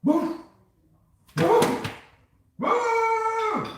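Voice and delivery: high, rising hoots; hooting at the dog; excited woo. Real, not acted. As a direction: whoop to get the dog going.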